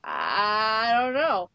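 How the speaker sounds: long, hesitant drawn-out start; imitating dumbstruck. Imitating people asked an awkward question, dumbstruck. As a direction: stall on the first word, mimicking someone stumped. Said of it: "they have this dumbstruck look on their face".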